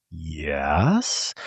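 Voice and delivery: low, soft, drawn-out questioning yes; imitating a suspicious boss; wary questioning. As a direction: answer guardedly, voice creeping upward. Wary sergeant answers the request.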